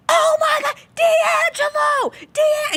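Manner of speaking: very high-pitched reenacted fan scream; excited yelling. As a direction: call out excitedly, stretching the name. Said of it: "and Chris is yelling".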